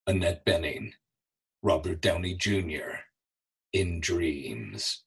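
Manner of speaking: low, hushed trailer voice with dramatic pauses; deep, soft announcer read, each name set apart; movie trailer read. Shown as a demonstration. Voice actor re-creating a trailer audition, low trailer voice. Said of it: "I get up and I read this".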